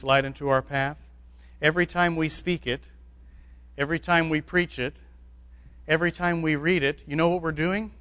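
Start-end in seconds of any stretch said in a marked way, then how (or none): none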